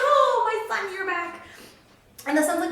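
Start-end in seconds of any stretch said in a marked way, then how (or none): 0.0-1.4 much higher and louder, bursting with joy; overjoyed father. Acted. The father greeting his returning son, overjoyed. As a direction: cry out like a father seeing his lost son. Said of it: "His father gets so excited".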